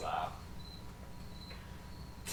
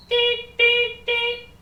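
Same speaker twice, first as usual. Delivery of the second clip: high, loud monotone beeps; imitated beeper; 12 dB louder, much slower than usual. He pulls out a little thing, high beeps. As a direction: mimic a little device beeping. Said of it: "He pulls out a little thing".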